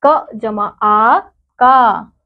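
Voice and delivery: drawn-out teacher demo of joining the letters; slow spelled-out blend. Shown as a demonstration. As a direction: slowly join the two sounds, k plus aa. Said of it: "आ की मात्रा".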